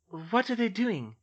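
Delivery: plain reading voice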